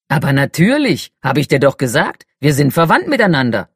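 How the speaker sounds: loud, hearty giant of a man; fire giant voice. Acted. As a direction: boom out a big friendly welcome. Talut greets his new kin.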